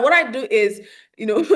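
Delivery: ordinary talk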